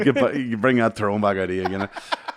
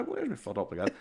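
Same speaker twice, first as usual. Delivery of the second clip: higher, softer puzzled question; playful dad; 7 semitones higher, 8 dB quieter than usual. Retold or imitated. Dad teasing small daughter, playfully puzzled. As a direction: play along as if baffled by a child.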